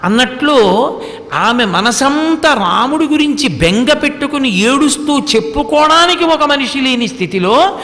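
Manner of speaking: ordinary talk, nothing marked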